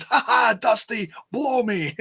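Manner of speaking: imitated gloating ringside yell; Russo yelling. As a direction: jeer it with a fake laugh. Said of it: "he yell".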